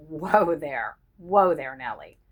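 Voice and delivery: lightly voiced traffic cop halting someone; a playful hint of a traffic cop; mock traffic cop. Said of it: "mock traffic cop style".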